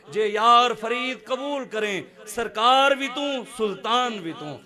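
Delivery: slow, building to loud fervour; devotional poem recital. Acted. Preacher reciting a kafi to the Prophet, growing fervent. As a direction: chant the verses, rising to a climax.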